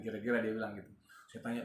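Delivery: ordinary delivery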